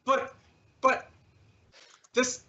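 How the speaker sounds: halting, bewildered pleading; stammering protest. Acted. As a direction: falter as your gift is rejected. Rejected suitor stammers at girlfriend.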